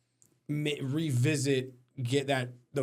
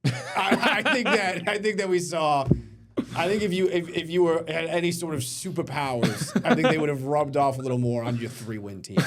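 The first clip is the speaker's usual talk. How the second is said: higher, laughter bubbling through the words; laughing mockery; 4 semitones higher, 4 dB louder than usual. Real, not acted. Host ribbing the coach about superpowers, laughing. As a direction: struggle to make the point through laughter.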